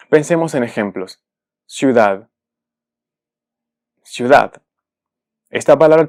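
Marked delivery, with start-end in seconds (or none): 1.6-2.3 ciudad as ciu-DAD; stress on last syllable. Shown as a demonstration. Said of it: "su sílaba tónica es la última". 4.0-4.6 ciudad said louder, ciu-DAD; stress on last syllable. Shown as a demonstration. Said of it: "su sílaba tónica es la última".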